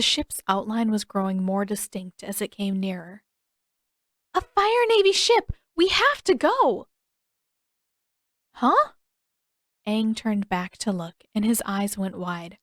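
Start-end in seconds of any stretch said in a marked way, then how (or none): 4.3-6.9 high, loud panicked call; alarmed shout about the ship; urgent alarm. A narrator's reading. Spots enemy ship, urgent alarm. 8.6-8.9 surprised huh. A narrator's reading. Surprised reaction.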